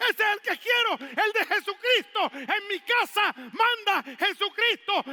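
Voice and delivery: loud, high preaching shout; fervent preaching. Acted. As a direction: preach it out to the congregation. Preaching at full force. (predicación a gritos)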